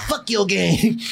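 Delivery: tiny little voice, muttered; tiny tinkling snowflake sprite. Retold or imitated. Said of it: "you hear a little voice go".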